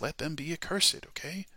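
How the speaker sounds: normal talking voice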